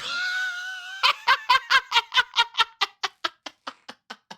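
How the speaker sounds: a big, high, shouted burst of laughter. Real, not acted. Gloating laugh after the taunt.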